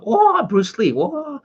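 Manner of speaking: high, taunting mock of a schoolyard jeer; sing-song it up high like a bully; mocking Australian bully. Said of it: "one Australian guy going".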